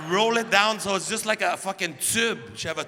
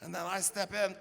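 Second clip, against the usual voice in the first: ordinary talk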